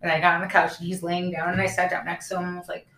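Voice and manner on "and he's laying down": ordinary talk, nothing marked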